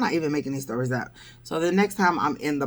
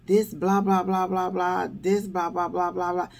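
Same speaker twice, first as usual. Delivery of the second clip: higher-pitched, rattled-off mock tirade; imitated rant; 4 semitones higher than usual. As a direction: imitate a guy going off. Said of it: "he is going the hell off".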